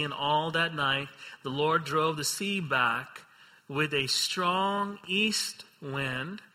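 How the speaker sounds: ordinary delivery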